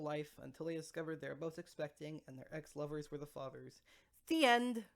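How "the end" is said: announcing. A narrator's reading. Closing the story.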